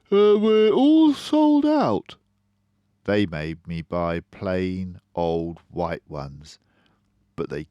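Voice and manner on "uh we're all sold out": high, loud, slow salesman voice; shoe salesman. Acted. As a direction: play the shoe man, pitched way up and loud, drawn out. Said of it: "the shoe man said".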